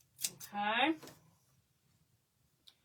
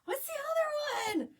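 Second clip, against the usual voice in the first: pitched-up playful whine; high squeaky groping. Real, not acted. Trying to recall a brand for viewers, squeaky. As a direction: fumble for the name comically.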